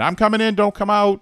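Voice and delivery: high, loud call through the door. Retold or imitated. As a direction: call out to whoever's inside. Calling into shared bathroom.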